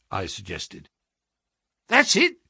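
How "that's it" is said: high-pitched exclamation of agreement; excited cry. A narrator's reading. Clerk agreeing they are suspected detectives, cried out. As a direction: seize on the idea eagerly. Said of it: "cried Pycroft".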